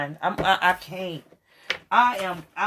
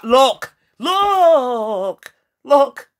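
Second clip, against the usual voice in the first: higher, louder pleading repeats; excited insistence; 6 semitones higher, 7 dB louder, much slower than usual. Real, not acted. Reader urging viewers to look at the card, near-crying excitement. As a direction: beg them to look, voice cracking.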